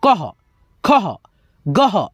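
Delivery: "कह, खह, गह", each ending in a breath of ह; visarga as "ह". Shown as a demonstration. Teacher demonstrating the visarga, h-ending "कह, खह, गह". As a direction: sound a final h on "कह, खह, गह". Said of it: "इसका उच्चारण ह होता है".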